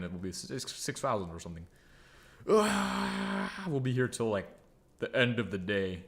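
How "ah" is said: long, high "aah" held out; drawn-out high wail of dismay; exasperated wail. Real, not acted. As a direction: let out a long, high moan. Dreads hours of extra work.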